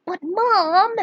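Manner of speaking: put-on high kid voice protesting; whiny protest. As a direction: complain like a grounded boy. Boy protests to his mother.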